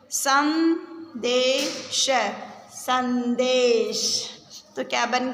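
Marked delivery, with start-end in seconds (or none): 0.1-2.1 संदेश drawn out very slowly with a rising end; slow syllable reading. Shown as a demonstration. Teacher sounds out संदेश for the class, very slowly. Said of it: "शब्दों को पढ़ेंगे". 2.7-4.3 संदेश said slowly again with a falling close; slow falling repeat. Shown as a demonstration. Teacher repeats संदेश for children, slowly. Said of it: "शब्दों को पढ़ेंगे".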